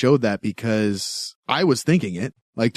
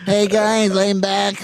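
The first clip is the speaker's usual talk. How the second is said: stiff mechanical robot voice; 6 semitones higher, slower than usual. Retold or imitated. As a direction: try a robot voice, high-pitched. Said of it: "He's trying to do the robot voice".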